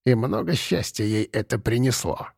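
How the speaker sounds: soft, hushed question; barely audible, almost to herself; very quiet. A narrator's reading. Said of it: "спросила баба Марфа так тихо".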